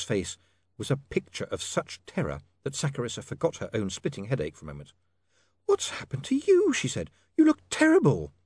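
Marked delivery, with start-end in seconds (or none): 5.6-8.3 put-on much higher, startled young lady; shocked at the state of him; alarmed. Saccharissa seeing Otto's face, alarmed and high.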